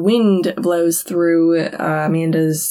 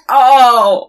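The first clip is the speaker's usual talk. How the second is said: loud, higher startled "Oh!"; disgusted gasp; 4 semitones higher, 7 dB louder than usual. Retold or imitated. Man finds maggots in his burger, loud shocked "Oh!". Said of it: "And he's like".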